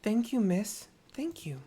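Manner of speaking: put-on high, small child's voice; little girl voice. Little girl thanking Tepeke, high child voice. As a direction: sound like a grateful little girl.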